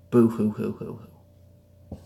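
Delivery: hammed-up pretend crying; mock boo hoo crying. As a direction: fake sobbing boo hoo.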